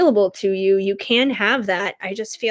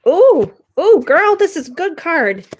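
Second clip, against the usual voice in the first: high, loud thrilled squeal; excited; 8 semitones higher, 5 dB louder than usual. Real, not acted. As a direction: gush over good news. Thrilled by a good card.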